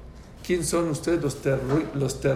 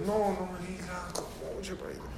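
soft, higher, pleading disbelief; stunned dismay; 4 semitones higher, 10 dB quieter than usual. Retold or imitated. Father hearing yet more bad news from the doctor, softly dismayed. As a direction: imitate a crushed parent protesting very quietly.

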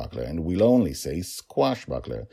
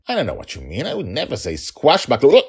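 loud, high protest of innocence; father playfully indignant; exaggerated innocence, rising on the last word; mock denial; 8 semitones higher, 8 dB louder than usual. A narrator's reading. Father playfully denies, blurts word.

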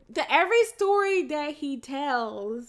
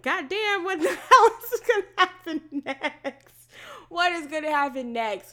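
alarmed; 5 semitones higher, 5 dB louder than usual. Retold or imitated. Retold alarmed reaction, laughing.

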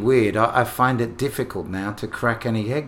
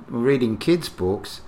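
ordinary talk, nothing marked

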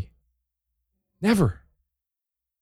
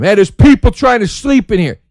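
louder, higher mock shout; pretend yell; 8 semitones higher, 7 dB louder than usual. Parent yelling at the early-rising eight-year-old, mock shout. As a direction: snap at the kid who woke you. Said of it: "I bet you wanna yell at him".

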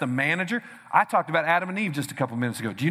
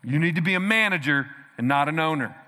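ordinary talk

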